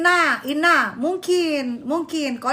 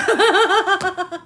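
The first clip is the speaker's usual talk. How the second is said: burst of laughter; 4 semitones higher, 4 dB louder than usual. Real, not acted. Laughing at the blanket.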